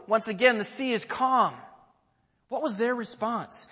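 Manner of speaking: ordinary talk, nothing marked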